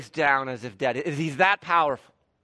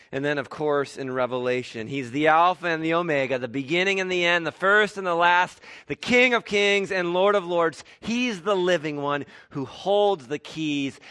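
rising, louder preacher's proclamation; full-force preaching; 2 semitones higher, 4 dB louder than usual. Acted. Preacher proclaiming Christ's titles to the congregation, full force. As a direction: preach it out to the hall, building.

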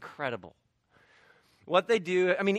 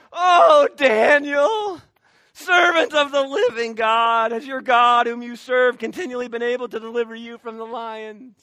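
anguished king crying out; 4 semitones higher, 8 dB louder than usual. Retold or imitated. King calling into the lions' den, anguished loud cry. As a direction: cry out for your friend in the den. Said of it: "I imagine the king's a little bit".

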